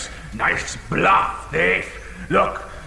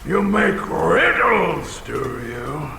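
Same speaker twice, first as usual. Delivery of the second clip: ordinary delivery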